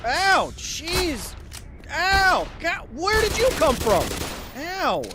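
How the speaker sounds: high, loud yelps of alarm; pained yelping. Real, not acted. Gamer hit by an unseen enemy, pained yelping. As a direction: yelp and cry out at each hit.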